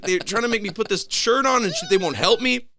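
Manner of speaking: high-pitched, loud tattling; exasperated complaint. Retold or imitated. Patient tells doctor nobody will help, exasperated. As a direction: protest loudly to whoever will listen.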